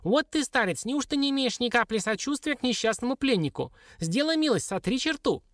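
high, squeaky wheedling dwarf; high-pitched plaintive appeal; pleading dwarf. Acted. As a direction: beg for sympathy in a little imp's voice.

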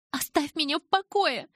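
shouting. A narrator's reading. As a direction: yell it running past her father. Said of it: "прокричала я".

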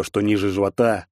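ordinary delivery

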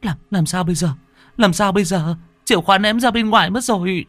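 high, stammering fluster; flustered panic. Acted. Younger sister realizes keys are outside, flustered panic. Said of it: "Cô Lan luống cuống".

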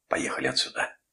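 voiceless, breathy urgent order; breathless shout. A narrator's reading. Father to the driver after wrestling the child, breathless urging. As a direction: gasp it out, short of breath. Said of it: "крикнул он, задыхаясь".

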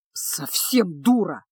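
slow exasperated scoff; rolling his eyes. A narrator's reading. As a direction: make it sound like she's hopeless. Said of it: "закатил глаза чародей". (медленно, с досадой, закатив глаза)

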